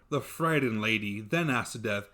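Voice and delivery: ordinary delivery, nothing marked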